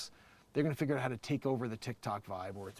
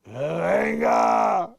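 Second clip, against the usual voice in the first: very slow, loud drawn-out yell of the name; stretched shout; 3 semitones higher, 12 dB louder, much slower than usual. Acted. Calling out the name in a long, loud yell. Drawn-out Scarface-style call.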